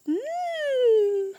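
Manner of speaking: squeaky mm of delight; a high tasting hum; high yummy mm. Acted. Teacher to kids, caterpillar savoring food, high yummy mm.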